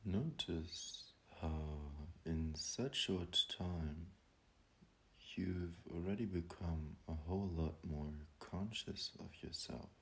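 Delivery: guided meditation. Acted. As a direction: ease them gently into calm, drawing the words out. Leads a breathing meditation.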